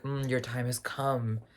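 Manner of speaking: soft luring hush; Rio's lure. Retold or imitated. Rio luring the dead, a soft mock hush. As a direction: coax them toward death, softly. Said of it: "be like".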